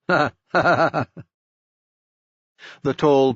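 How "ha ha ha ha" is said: forced, higher ha ha ha ha; a brittle, pettish laugh; mock cheerful laugh, strained. A narrator's reading. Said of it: "With a pettish laugh".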